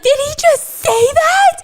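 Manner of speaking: high, loud mock-fan shriek, drawn out; losing their minds. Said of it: "fans in the crowd are losing their minds".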